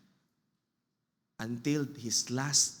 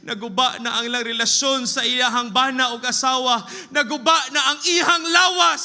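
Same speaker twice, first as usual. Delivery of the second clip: rising from strong to full shout; preaching crescendo to a full shout; building shout; 9 semitones higher, 10 dB louder than usual. Real, not acted. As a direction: build each line louder and higher.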